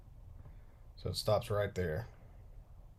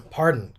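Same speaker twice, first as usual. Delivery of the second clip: coughing fit; 3 semitones higher, 9 dB louder than usual. Real, not acted.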